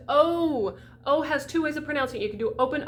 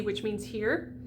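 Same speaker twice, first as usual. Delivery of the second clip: ordinary talk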